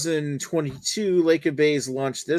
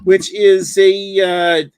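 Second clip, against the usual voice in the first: fake Italian accent; 6 semitones higher, 10 dB louder, much slower than usual. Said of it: "fake Italian accents".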